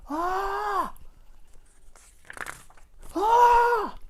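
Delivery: high, drawn-out mock cry; mimed big "ah". Shown as a demonstration. Reader imitating Mister Noisy's big gestures, mimed cry. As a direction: sing out a high, long "ah". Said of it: "his big gestures here, like".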